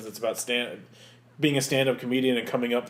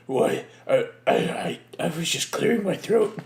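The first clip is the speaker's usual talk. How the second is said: flustered Batman voice; 3 semitones higher than usual. Retold or imitated. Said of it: "how did Batman find his voice".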